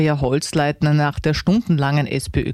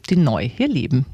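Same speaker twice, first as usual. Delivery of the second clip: normal talking voice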